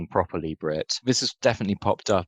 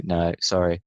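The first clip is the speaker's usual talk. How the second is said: ordinary delivery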